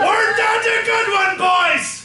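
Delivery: loud boisterous pirate captain; pirate captain shout. Acted. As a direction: bellow like a jolly captain.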